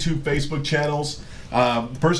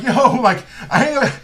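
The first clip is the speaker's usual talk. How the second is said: laughing protest; 9 semitones higher, 5 dB louder than usual. Retold or imitated. Retold protest while being beaten.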